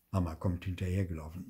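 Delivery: ordinary delivery, nothing marked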